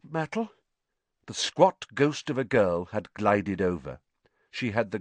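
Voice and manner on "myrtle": high, forced hello, dropping off; flustered, too-loud hello that tails away; awkward greeting. Acted. Caught out, greets the girl awkwardly.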